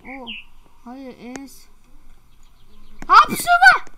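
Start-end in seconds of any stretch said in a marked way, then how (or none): 3.1-3.8 sneezing. Real, not acted. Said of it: "arkadaşlar hapşırıyor".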